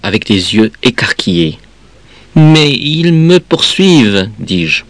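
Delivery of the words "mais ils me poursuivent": high, slow plaintive protest; drawn-out childish complaint, pitched up; distressed child. Acted. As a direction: wail it slowly like a hounded child. Tearful child protests to mother.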